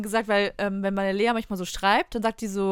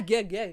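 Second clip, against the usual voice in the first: gagging noise. Retold or imitated. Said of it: "so reingezogen hat".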